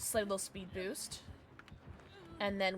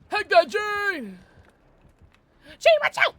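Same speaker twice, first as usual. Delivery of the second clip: loud, high yell; urgent warning shout; 10 semitones higher, 12 dB louder, slower than usual. Real, not acted. Player warning teammate Jane in the game, urgent yell.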